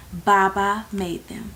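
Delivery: ordinary delivery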